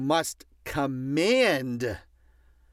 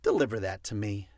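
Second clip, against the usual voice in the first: lower, quick and firm; crisp low command; 6 semitones lower, faster than usual. Shown as a demonstration. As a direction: give the order, no asking. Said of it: "you say to your assistant".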